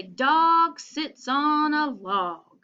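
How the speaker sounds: each word spelled out slowly and clearly; slow reading demo. Shown as a demonstration. Teacher showing children how to read the line, slow and clear. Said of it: "So you say".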